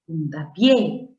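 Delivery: Puntapié slowly, punching the final PIÉ; stress on last syllable. Shown as a demonstration. Teacher demonstrating an aguda word, stress on PIÉ in Puntapié. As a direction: draw it out and land hard on pié in Puntapié. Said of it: "se convierte en una palabra aguda".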